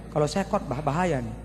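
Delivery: ordinary delivery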